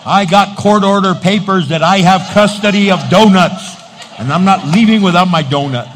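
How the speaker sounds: stubborn, loud complaint; indignant donut demand. Retold or imitated. Preacher voicing churchgoers refusing to leave without donuts, loud and indignant. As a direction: plant your feet and refuse to leave. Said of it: "they said".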